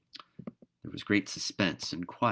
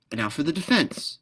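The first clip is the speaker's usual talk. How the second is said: higher, louder brisk announcement; 8 semitones higher, 4 dB louder than usual. Acted. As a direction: say it briskly, moving on. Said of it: "said the swallow briskly".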